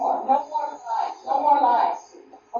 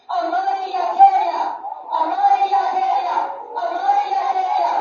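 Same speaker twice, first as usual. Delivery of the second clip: loud, higher chant; call and response; 6 semitones higher, 3 dB louder than usual. Real, not acted. Preacher leads the congregation chanting for peace, loud. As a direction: lead the crowd chanting. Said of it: "I want you to say it with me".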